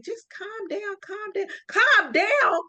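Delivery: high, drawn-out calming voice; soothing mom. Retold or imitated. Mom calming her bleeding daughter on the phone, slow and high. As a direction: coax a panicking child to calm down. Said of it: "And my mom was like".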